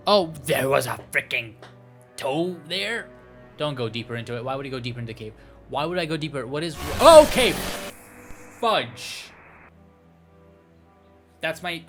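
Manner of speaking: usual speaking voice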